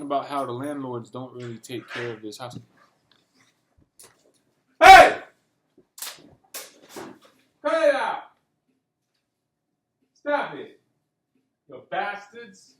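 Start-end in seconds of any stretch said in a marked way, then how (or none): 4.8-12.7 a very loud, high bark of "Hey!" that eases to a slower scold; yelling at the dogs. Real, not acted. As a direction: stop the dogs fighting, then grumble at them.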